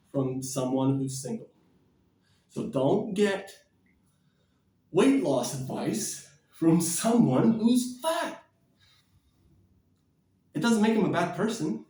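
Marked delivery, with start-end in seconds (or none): 2.5-8.4 slow, each word weighed out; slow emphatic. Real, not acted. Warning viewers about fat advisers, slow and emphatic. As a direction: lay out "So don't get weight loss advice" slowly and pointedly. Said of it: "don't get dating advice".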